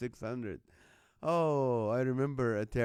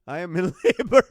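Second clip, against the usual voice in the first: laughs through the words, loud; cracking up mid-line; loud laughing delivery; 10 semitones higher, 10 dB louder than usual. Real, not acted. Laughing while joking about labor.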